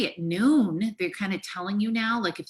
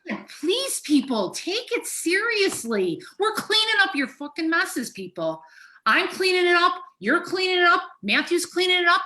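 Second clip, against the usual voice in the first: high-pitched, upset outburst; impassioned plea, voice pushed way up; distraught pleading; 10 semitones higher, 3 dB louder than usual. Real, not acted. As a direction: beg people in real exasperation. Emotional plea to careless people.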